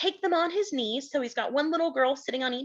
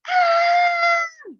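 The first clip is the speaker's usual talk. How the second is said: high, loud drawn-out shriek; panther scream; 8 dB louder than usual. Acted. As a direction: scream like a hungry panther right behind. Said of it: "the panther screamed close behind".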